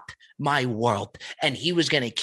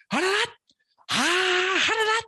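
imitated yell; much slower than usual. Sushi chef yelling through the window to the kitchen, very high imitated yells. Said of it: "And he's just yelling".

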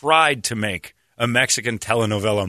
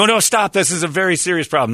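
mock earnest protest; 7 semitones higher, 3 dB louder than usual. Imagined earnest activist.

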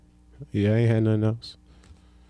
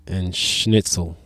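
mock German accent; 7 semitones lower, 4 dB quieter, slower than usual. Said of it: "I just say nine at the end of everything".

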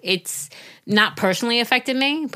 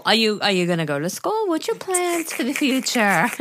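nosy nail salon guy; 3 semitones higher than usual. Retold or imitated. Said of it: "The guy at the nail salon".

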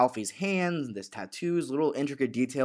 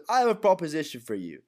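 higher-pitched acted offer; put-on, lifted gangster delivery; mistaken Alfie voice; 4 semitones higher than usual. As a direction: pitch the quote up in a character voice. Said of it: "well, Lucas says that".

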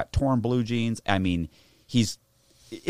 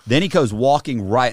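ordinary delivery

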